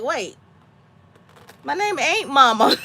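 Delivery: usual speaking voice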